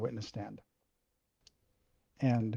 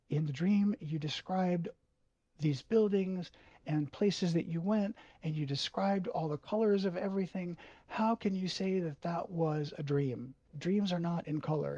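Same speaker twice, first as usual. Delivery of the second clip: higher, louder hard questioning; interrogating; 6 semitones higher, 3 dB louder than usual. Retold or imitated. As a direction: grill him like a lawyer. Father interrogating.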